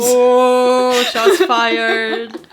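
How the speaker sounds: big mock-shocked holler; playful hype. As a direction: whoop it like a crowd reacting to a burn. Mock reaction to cutting quote.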